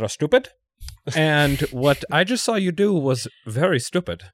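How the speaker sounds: put-on lightly done Swedish accent, polite and slow; a hint of a prim Swedish guard, overly courteous. Swedish security guard scolds skater.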